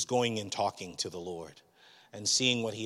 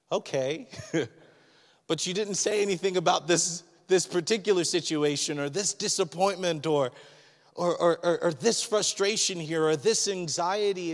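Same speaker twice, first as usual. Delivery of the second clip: higher, louder pleading protest; complaining to God; 8 semitones higher, 5 dB louder than usual. Retold or imitated. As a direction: push back at God, a bit exasperated. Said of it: "And I'm like".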